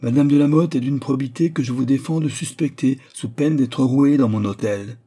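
angry nobleman defending a lady's honour; indignant threat. Acted. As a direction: forbid it forcefully, slowing into the threat.

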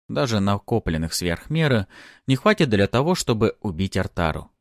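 plain reading voice

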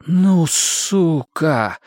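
very slow, drawn-out astonishment; amazed exclamation. A narrator's reading. Duty officer astounded by detainee's threats, slow amazed curse. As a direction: stretch it out, stunned. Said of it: "изумился дежурный".

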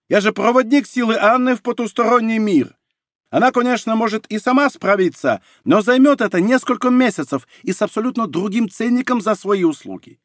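high-pitched, insistent patter; emphatic sales pitch. A narrator's reading. Middleman selling his services to clients, emphatically.

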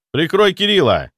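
loud high order in battle; cover me shout. A narrator's reading. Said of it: "крикнул я".

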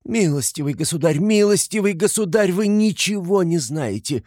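high-pitched cry, climbing on the repeat; shouting. A narrator's reading. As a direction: protest hotly over the gentleman's words. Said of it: "кричала Катерина Ивановна". (кричит высоко, с нарастанием)

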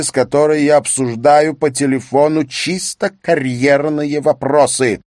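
indignant husband. Acted. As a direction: cut in forcefully on your wife. Husband cuts in on quarrel.

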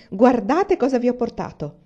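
loud, happy call to the family; proud, jubilant announcement; excited exclaiming. A narrator's reading. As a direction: burst in with good news.

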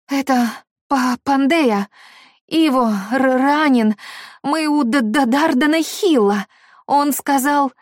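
high, broken sobbing stammer; tearful babbling. A narrator's reading. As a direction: babble through tears, barely able to speak. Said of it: "Едва ворочая языком, пролепетала Пандея".

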